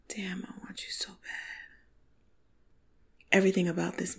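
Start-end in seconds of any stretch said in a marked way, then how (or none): 0.1-1.8 soft breathy whisper of desire; sexy whisper. Retold or imitated. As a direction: whisper it close into an ear. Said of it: "this sexy baritone voice in my ear".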